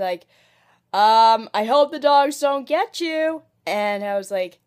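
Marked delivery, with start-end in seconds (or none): 0.9-3.4 mock call after him. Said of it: "and I said".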